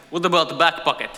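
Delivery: put-on Russian-accented question; Russian stranger's accented English. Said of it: "the guy says".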